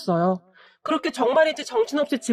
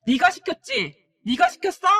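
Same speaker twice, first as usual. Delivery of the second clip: higher-pitched accusing outburst, mildly acted; angry yelling; 7 semitones higher than usual. A narrator's reading. Boyfriend's mother accuses the narrator in the lobby, mock angry yell. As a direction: narrate a restrained angry shout. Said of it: "소리를 질렀는데요".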